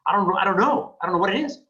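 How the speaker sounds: a hammed-up confused realtor; clueless agent. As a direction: play the stumped realtor who has no idea. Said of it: "listen, you're thinking".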